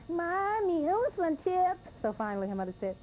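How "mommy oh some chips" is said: put-on little girl. Little girl begging again.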